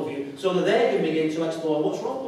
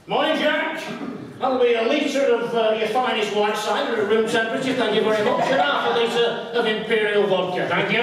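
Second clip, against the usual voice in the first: higher, chirpy voice ordering booze; mock cheery customer; 5 semitones higher than usual. Drinker greeting shopkeeper Jack, chirpy mock voice. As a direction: play a breezy regular at the counter.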